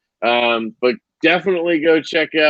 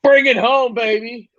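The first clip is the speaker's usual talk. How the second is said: hype call; 9 semitones higher than usual. Acted. Handing sign-off to Kyle.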